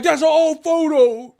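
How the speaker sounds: high, loud, slow whiny mock voice; nasal-free squeaky parody of a fan. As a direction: drag it out like a sulky fan. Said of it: "they're like".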